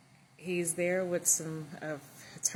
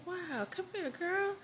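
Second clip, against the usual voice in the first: delighted greeting; 10 semitones higher than usual. Retold or imitated. Old friend greets her warmly.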